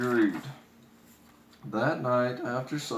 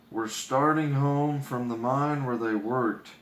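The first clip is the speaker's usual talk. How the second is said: plain reading voice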